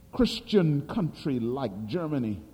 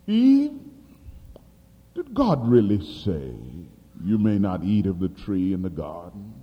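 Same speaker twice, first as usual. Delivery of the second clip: serpent's voice; 9 semitones lower than usual. Retold or imitated.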